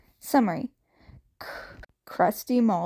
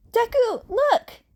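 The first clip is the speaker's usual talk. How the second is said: high, loud gasping call; excited gasp; 12 semitones higher, 6 dB louder than usual. A narrator's reading. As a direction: gasp and point it out. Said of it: "Uraraka gasped".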